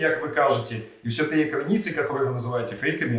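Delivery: normal talking voice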